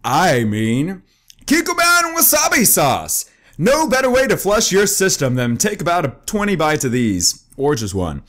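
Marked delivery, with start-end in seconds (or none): 1.4-5.3 mock ad announcer. Announcing the sauce like an ad.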